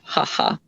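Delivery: soft, voiceless "ha ha"; dry mock laugh, dropped quiet; mimicked fake chuckle. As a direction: imitate a dismissive little laugh. Mimics abuser joking about the stabbing.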